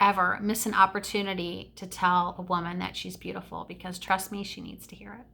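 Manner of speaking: normal talking voice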